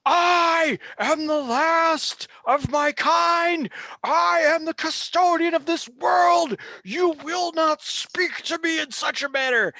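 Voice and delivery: thunderous affronted proclamation, high and loud; offended ancient being. Acted. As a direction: proclaim it as a wounded, towering custodian. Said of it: "grievously offended at your words".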